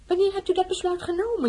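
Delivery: scherp en hoog geschrokken; de koningin schiet verontwaardigd uit; geschokt. Acted. Koningin geschrokken door vertrekplan. (geschokte uitroep, hoog en luid)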